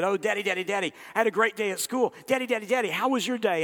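put-on high, pestering child's voice calling his dad; a little kid tugging for attention, voice up high; eager little boy. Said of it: "and he said".

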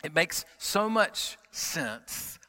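ordinary talk

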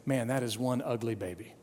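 low, soft and grave, dead earnest; very serious. Retold or imitated. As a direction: deliver it like a solemn verdict. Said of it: "in a very serious tone".

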